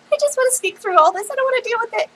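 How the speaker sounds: high, quick whiny complaint; mock whine. Voicing own whine about playing priest.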